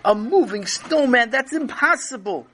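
high-pitched disbelief; incredulous. A narrator's reading. Tati seeing the moving snowman, incredulous. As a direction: exclaim it as impossible.

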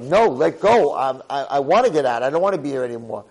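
loud, insistent mock pleading; urgent protest. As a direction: protest loudly as if struggling to break free. Brother struggles to get free.

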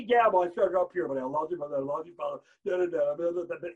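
loud start, sinking into garbled babble; mock objector. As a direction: brush it off, trailing into nonsense syllables. Mocks a dismissive objector.

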